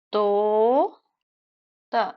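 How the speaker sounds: तोता as a very long "tooo", pause, then "ta"; syllable by syllable. Shown as a demonstration. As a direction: stretch तोता slowly as if writing it. Sounding out while writing.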